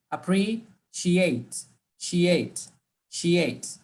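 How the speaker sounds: "appreciate" broken, then "she ate" alone, falling; second half drilled. Shown as a demonstration. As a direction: drill the ending "she ate" slowly, with pauses. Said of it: "So we cut it in two".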